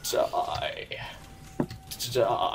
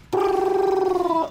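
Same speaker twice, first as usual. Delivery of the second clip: imitated gunfire; 6 dB louder than usual. Real, not acted.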